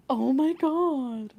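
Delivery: slow, drawn-out "Oh my God"; Fluff's loud catchphrase. Retold or imitated. Showing the chat how Fluff says his catchphrase, slow and drawn-out. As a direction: stretch every word like he's stunned. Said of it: "really loud".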